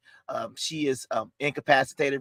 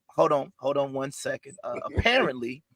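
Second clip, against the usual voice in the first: ordinary talk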